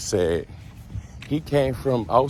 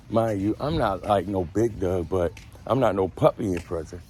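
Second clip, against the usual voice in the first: ordinary talk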